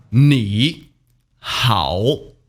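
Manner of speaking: 你 and 好 apart, each dipping low; separate third tones. Shown as a demonstration. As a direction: say 你, pause, 好, each falling then turning up. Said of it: "pronounced in the third tone when spoken individually".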